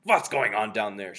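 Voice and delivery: loud demand from the platform; shouted. A narrator's reading. Grand High Witch glaring down from the platform, shouted. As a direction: shout it down at the crowd. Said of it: "shouted the Grand High Witch".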